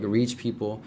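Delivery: ordinary delivery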